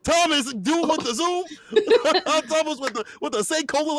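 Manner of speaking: high-pitched, through laughter; amused outburst. Real, not acted. Commentator teasing a player's name, laughing and high. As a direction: crack up while calling out the player.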